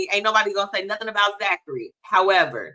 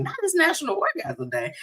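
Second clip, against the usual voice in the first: mock disbelief; 12 semitones higher, 5 dB quieter than usual. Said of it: "I was like".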